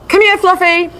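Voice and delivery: high sing-song call across the park; calling the dog. Retold or imitated. Owner calling dog at the park, high call. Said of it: "you call your dog".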